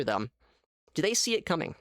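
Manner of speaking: usual speaking voice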